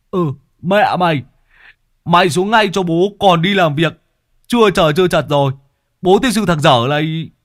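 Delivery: loud, high furious scolding; scolding hoarse. A narrator's reading. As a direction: yell until the throat burns. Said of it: "Lâm Sẹo mắng đến rát cổ". (Lâm Sẹo mắng đến rát cổ)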